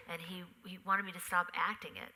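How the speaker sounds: usual speaking voice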